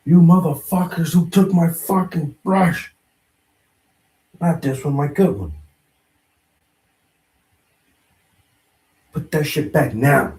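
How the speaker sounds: loud angry yell at the room; yelling across the room. Real, not acted. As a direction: shout to people in another room. Shouting at people for brush.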